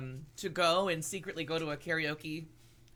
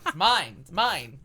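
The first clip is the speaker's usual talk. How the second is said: excited; 8 dB louder, slower than usual. Real, not acted. Excited over the gift.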